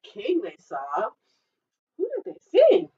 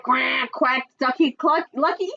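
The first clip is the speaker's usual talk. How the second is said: ordinary delivery, nothing marked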